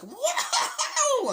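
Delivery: very high, loud whooping squeal; imitated Toad squeal. Toad thrilled in the kart, very high squeal. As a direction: squeal as if on a wild ride. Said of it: "And the Toad's like".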